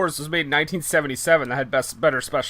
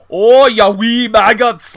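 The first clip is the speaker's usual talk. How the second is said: shouted, hammed-up Scottish brogue, slow and much higher; terrible Scottish accent; 7 semitones higher, 12 dB louder, much slower than usual. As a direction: bellow it like a cartoon Scottish villain, drawn out. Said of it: "doing a terrible Scottish accent".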